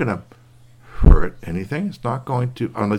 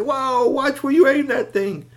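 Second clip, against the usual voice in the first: much higher, slow startled scold; startled warning; slower than usual. Acted. Curtis nearly hit by the marble, high startled warning. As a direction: yelp and scold together.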